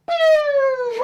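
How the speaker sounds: very high, loud whistling pew; a cartoon falling whistle; falling sound effect. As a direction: mimic something dropping fast.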